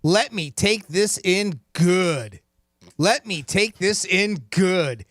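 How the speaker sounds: very slow, drawn out; slow quiz reading. Shown as a demonstration. Host reading the quote to David, very slow. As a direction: stretch each word for the caller. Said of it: "Here is your quote".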